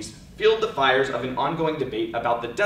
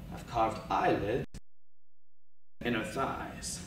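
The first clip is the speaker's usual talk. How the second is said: spoken word poetry; 7 dB quieter than usual. Acted. Poet telling the audience about the morgue, hushed spoken word. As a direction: let the images land in hushed pauses.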